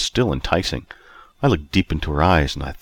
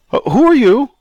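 high, loud, startled question; 7 dB louder than usual. A narrator's reading. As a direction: blurt it out in alarm. Startled question spoken in unison.

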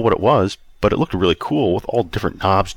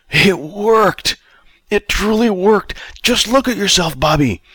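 thrilled cry; 12 semitones higher, slower than usual. Acted. Old Robert exulting to Rob that his plan worked. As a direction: crow with delight at success.

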